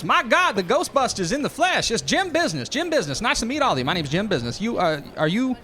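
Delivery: high-pitched, loud, hammy fake Texas accent; bad fake Texas accent. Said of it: "his Texas accent, is bad and fake".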